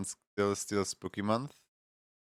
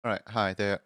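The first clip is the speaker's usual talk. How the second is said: normal talking voice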